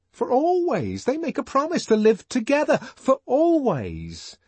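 put-on high, earnest young man's voice, pleading; John the Savage. John pleading with Lenina, high and fervent.

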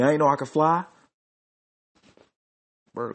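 ordinary talk, nothing marked

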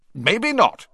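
high, curt retort; heated comeback; argumentative. A narrator's reading. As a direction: answer back sharply, pitched high. Quarrel between the two ministers.

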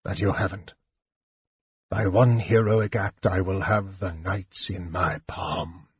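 low hiss of hatred, held back; a soft voice trembling with hate; soft, hateful. A narrator's reading. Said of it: "His voice was soft and shaking with hatred".

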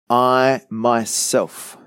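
I myself, drawn out very slowly, each word weighted; emphatic. Retold or imitated. Quoting God's promise to the congregation, slow and emphatic. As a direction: lean hard on I myself, taking your time.

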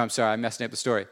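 ordinary delivery

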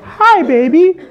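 put-on high, sweet-talking "Hi, baby". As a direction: pitch it way up like a smooth talker. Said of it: "I don't think the girl likes voice like that".

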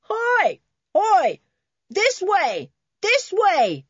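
high-pitched calling out; distant shout. A narrator's reading. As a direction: call from afar so rescuers can find you. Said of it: "from somewhere in the near distance came a shout".

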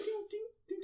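high, soft ding ding. Retold or imitated. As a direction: chime it high and light. Imitating watch reflection.

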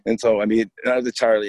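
ordinary delivery

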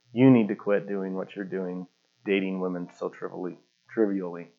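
a hint of a mentor's low, soft admonishment; lightly voiced youth leader. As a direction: softly take a young man to task. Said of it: "the guy that was the youth leader".

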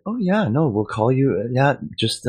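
high-pitched mock receptionist voice; imitated evasive phone staffer, pitched well up; office staffer. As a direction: brush the caller off in a high, put-on voice. Imitates ombudsman's office brushing him off.

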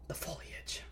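fully whispered reveal; hushed whisper. Pretend. Host revealing what Long Islanders love, whispering. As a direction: drop to a soft, awed whisper. Mock-reverent reveal of autumn leaves.

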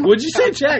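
high, laughing disbelief; incredulous retold question. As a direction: ask it high-pitched, through laughter. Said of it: "I was like".

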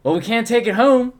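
high, loud mock exasperation; imitating a worked-up objection; retold protest. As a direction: protest with the voice pushed up. Retold protest over too much beer.

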